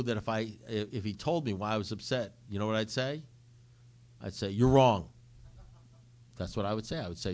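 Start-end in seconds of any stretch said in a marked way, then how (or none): 4.5-5.1 loud, emphatic imagined comeback; knee-jerk retort. Retold or imitated. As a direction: bat the idea away at once. His knee-jerk retort to sponsor.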